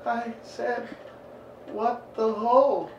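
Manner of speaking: put-on high, halting boy's confession; mock scared kid.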